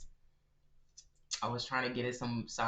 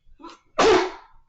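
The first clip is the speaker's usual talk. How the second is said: sudden explosive sneeze; loud sneeze; 20 dB louder than usual. Real, not acted. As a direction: let a huge sneeze burst out. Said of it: "That was an aggressive sneeze".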